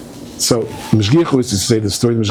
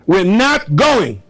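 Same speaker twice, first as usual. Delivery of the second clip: high, slow, stubborn refusal; defiant protest; 8 semitones higher, 3 dB louder, much slower than usual. Retold or imitated. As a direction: souls in heaven refusing to descend, defiant. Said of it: "The Neshamas are saying".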